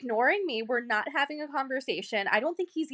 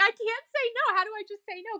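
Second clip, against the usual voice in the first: anxious inner voice; 11 semitones higher, faster than usual. Retold or imitated. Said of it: "and I'm like".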